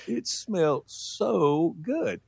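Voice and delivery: very slow, savoring each word; dragged out. Retold or imitated. Kid savoring the smell of gas, very slow. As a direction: linger on it like it's a treat.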